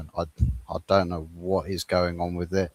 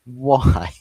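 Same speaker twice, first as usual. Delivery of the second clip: exasperated why; 4 semitones higher, 8 dB louder than usual. Real, not acted. Ranting at Pep's formation.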